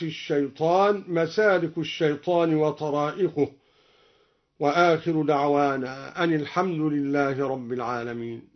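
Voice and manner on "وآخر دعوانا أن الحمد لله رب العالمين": ordinary talk, nothing marked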